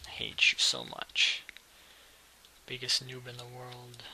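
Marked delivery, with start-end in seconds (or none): 0.1-1.4 much lower, slow unvoiced grumble; seething growl. Real, not acted. Gamer cursing his rival under breath, seething. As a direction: hiss it through clenched rage.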